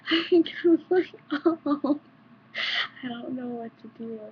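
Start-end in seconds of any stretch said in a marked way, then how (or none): 0.0-2.0 high laughing oh; embarrassed laugh; laughing awkwardly. Real, not acted.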